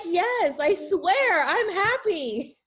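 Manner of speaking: high-pitched, drawn-out pleading; hammed-up, high protest of happiness; mock-desperate insistence. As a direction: insist as if nobody believes you. Acted insisting to doubters.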